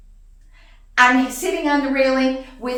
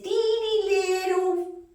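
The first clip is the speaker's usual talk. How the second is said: high, stretched-out words for tininess; high-pitched baby-talk emphasis on teeny; teeny-tiny squeal; 8 semitones higher, slower than usual. Retold or imitated. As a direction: squeak it out, slow and small. Mimes the snake's tiny size.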